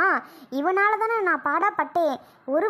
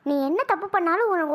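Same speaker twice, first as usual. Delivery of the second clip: ordinary talk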